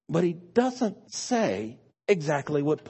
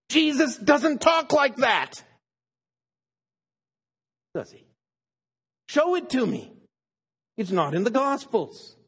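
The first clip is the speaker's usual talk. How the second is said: emphatic challenge; 8 semitones higher, 5 dB louder than usual. Real, not acted. Preacher challenging his congregation, emphatic and loud. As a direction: push back hard on the easy reading.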